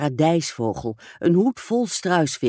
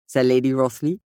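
plain reading voice

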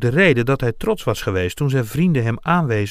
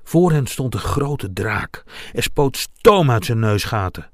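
ordinary delivery, nothing marked

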